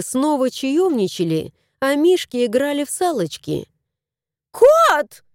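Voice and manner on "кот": much higher, louder outraged yell; woman yelling for the cat; indignant shout. A narrator's reading. Женщина возмущённо кричит на кота. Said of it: "возмущённо крикнула она".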